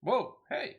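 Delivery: high, loud startled yelp; sudden shout of surprise. Acted. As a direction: yelp as you're nearly bowled over. Nearly knocked over by Lalafell.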